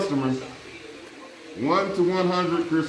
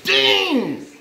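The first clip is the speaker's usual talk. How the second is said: high, loud hype over a near miss; big loud high-pitched reaction; excited reaction; 9 semitones higher, 4 dB louder, much slower than usual. Real, not acted. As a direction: holler it out, amazed at how close. Reacts to a very close guess.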